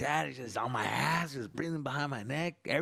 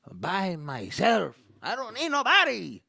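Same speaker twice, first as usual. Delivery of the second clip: much higher stubborn Mexican dad; proud Mexican dad; 6 semitones higher, 4 dB louder than usual. Retold or imitated. Dad bragging he needs no help, high and stubborn. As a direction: boast of doing it alone. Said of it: "they wanna say".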